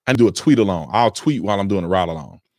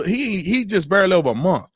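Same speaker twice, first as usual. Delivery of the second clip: usual speaking voice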